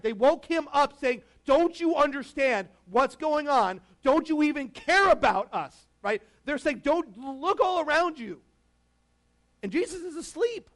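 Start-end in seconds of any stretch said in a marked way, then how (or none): 1.4-5.9 loud, high, drawn-out reproach; panicked pleading. Acted. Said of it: "invite Jesus into their panic". 6.8-8.4 high, slow, stretched-out plea; panicked urging. Acted. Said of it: "invite Jesus into their panic".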